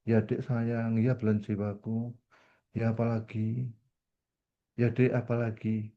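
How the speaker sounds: calm the angry wife softly; soothing husband. Retold or imitated. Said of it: "kalau saudara istri marah".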